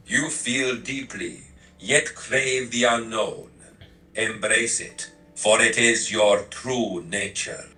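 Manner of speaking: put-on slow, measured Transylvanian Romanian accent. Assistant concluding the personality type, slow Transylvanian Romanian accent. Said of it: "change it to Transylvanian Romanian".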